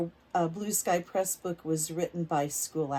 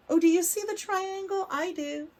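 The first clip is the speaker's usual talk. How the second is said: excited question; 10 semitones higher, 4 dB louder than usual. Real, not acted. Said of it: "asks children a question".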